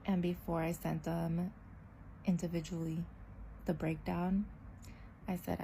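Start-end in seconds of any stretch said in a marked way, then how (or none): none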